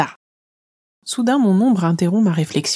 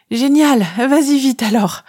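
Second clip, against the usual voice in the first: excited; 7 semitones higher, 4 dB louder than usual. Acted. Relieved, eager reply to shadow.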